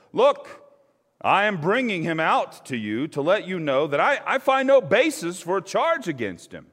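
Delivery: high, loud public announcement; Pilate proclaiming. A narrator's reading. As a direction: call it out over the crowd. Pilate addressing the crowd.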